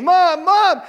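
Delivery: loud, high cry for mother; imitated children's screaming. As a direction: yell like desperate kids calling out. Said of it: "they start screaming".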